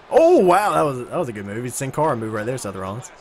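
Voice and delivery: ordinary delivery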